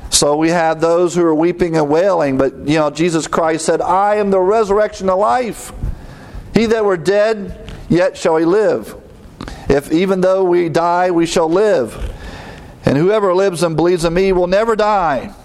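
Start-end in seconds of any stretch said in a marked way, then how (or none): 3.8-15.3 higher, measured preacher's reading; scripture proclamation. A narrator's reading. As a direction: proclaim the verse with raised pitch.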